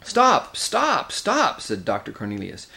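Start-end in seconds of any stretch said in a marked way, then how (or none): 0.0-1.6 high, urgent cries to halt; each word a sharp high interjection; urgent protest. Acted. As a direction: cut in on a quarrel, pitched high. Doctor breaks up a quarrel.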